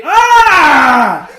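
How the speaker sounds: loud laughing shout; laughing yell. Real, not acted. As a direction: let out a big laughing yell. Laughing outburst ranting about Bane.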